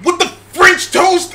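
high-pitched, thwarted attempt at cursing; Deadpool. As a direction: wind up to swear and miss. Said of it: "Imagine Deadpool trying to curse".

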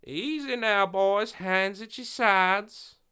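high, slow deputy warning; deputy holding a rifle on the gunmen, slow warning; steady warning. Acted. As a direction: draw it out, calm and dangerous. Benny holds gunmen at riflepoint.

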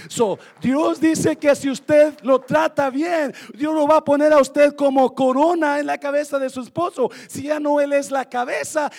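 loud high preaching shout; full force preaching. Acted. Preacher shouting to the church about wives as crowns. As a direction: thunder it out to the congregation.

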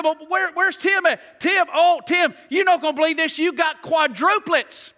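high, loud hollering across the room; hammed-up nurse yelling for Tim; mock nurse calling out.

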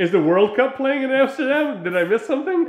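high, loud mock disbelief; mock outrage. Real, not acted. Friend riffs on the booked-out city in high, loud mock disbelief. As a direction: ham up the outrage at a full city.

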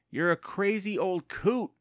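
loud, high, slow drawn-out taunt; teasing call. A narrator's reading. Evan calls to his deaf aunt, teasing call. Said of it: "Evan called to Catherine".

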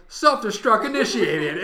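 loud, high, slow system alert; computer announcement. Retold or imitated. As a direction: boom it out like an alarm voice. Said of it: "and it's like".

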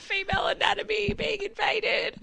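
hammed-up high tearful blubbering; mock wailing. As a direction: wail it like a melodramatic sob story. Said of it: "I was like".

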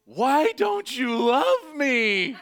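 long, drawn-out high scream; anguished scream. Retold or imitated. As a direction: wail it at full volume, stretching every word. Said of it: "I literally screamed".

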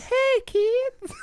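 very high, put-on creepy voice. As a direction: squeak a creepy greeting to kids. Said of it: "He's like".

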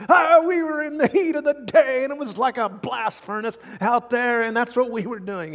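milk the heat like a martyr; dramatic complaining. Acted. Workers dramatically complaining about the heat, high and loud whine. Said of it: "being a little bit dramatic".

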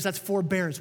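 ordinary talk

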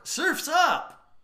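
high, swooping mock catchphrase; quoted cheesy one-liner. Writer questioning the joke, high mock catchphrase. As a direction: toss it up high as a corny gag. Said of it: "how are you gonna punch up that joke?".

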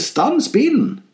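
high, drawn-out mock yell; high-pitched acted shout, stretched out; shouting inner voice. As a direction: replay the urgent high cry. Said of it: "den stemmen som ropte".

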